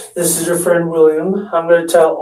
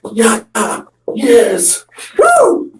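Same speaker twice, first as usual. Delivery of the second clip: high gleeful whoops; cheering; 6 semitones higher, much slower than usual. Retold or imitated. Kid cheering in the mirror at the breakup news. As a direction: celebrate like you won something.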